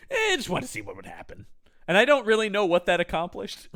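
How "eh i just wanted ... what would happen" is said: high, fast shrugging aside; careless mad scientist. Retold or imitated. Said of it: "and he goes".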